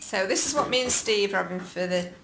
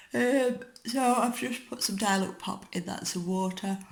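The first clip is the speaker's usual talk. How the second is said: yawning; 4 semitones higher than usual. Real, not acted. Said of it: "Sorry, I'm yawning".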